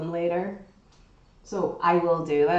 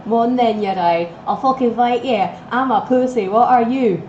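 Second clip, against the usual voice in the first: a hammed-up tough-guy threat, louder; imitating the voice heard; 3 semitones higher, 7 dB louder than usual. Retelling the voice she heard talking from outside, mock tough threat. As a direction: put on the mock hard-man voice she heard. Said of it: "and I hear talking".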